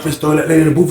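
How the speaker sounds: ordinary talk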